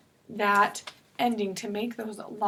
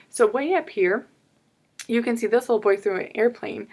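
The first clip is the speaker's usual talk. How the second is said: usual speaking voice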